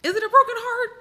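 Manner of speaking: high, loud disbelieving question; high-pitched skeptical disbelief; incredulous. Real, not acted. Incredulous reaction to story.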